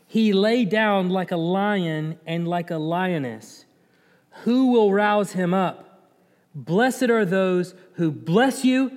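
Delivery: slow, measured proclamation; solemn scripture reading. Acted. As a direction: draw out each phrase of the blessing. Reads the blessing from scripture.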